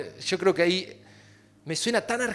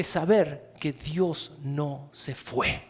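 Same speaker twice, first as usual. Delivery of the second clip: slow emphasis; 3 semitones lower, much slower than usual. Shown as a demonstration. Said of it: "Alguien tiene que oír esto".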